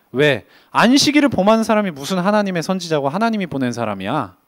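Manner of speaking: ordinary delivery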